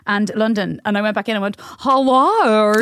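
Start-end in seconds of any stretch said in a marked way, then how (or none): 1.6-2.7 exaggerated Australian greeting, louder; Australian accent. Nervous actor at an audition, broad Australian accent. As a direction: put on a full Australian accent. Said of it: "I just did a full Australian accent".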